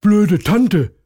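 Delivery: loud high sulky rabbit; insult. A narrator's reading. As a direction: spit it after her, high and loud. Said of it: "sagt Hasen Tom".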